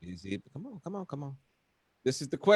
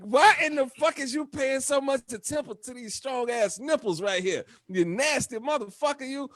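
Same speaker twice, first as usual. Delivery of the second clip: mock reply; 10 semitones higher than usual. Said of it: "I be like".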